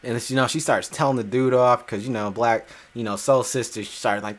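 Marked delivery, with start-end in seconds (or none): none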